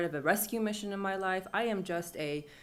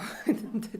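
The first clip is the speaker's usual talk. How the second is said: rueful laugh; 4 semitones higher than usual. Real, not acted. Laugh at naive plan.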